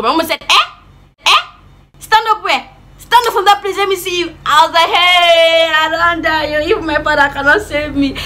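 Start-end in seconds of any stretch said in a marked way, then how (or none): 0.4-4.3 very high, incredulous mimicked challenge; outraged mother. Mom challenging child to stand, high indignant mimicry. 4.9-8.2 high, loud wail of doom; mock despair. Child realising dad cannot save her, high wailing lament.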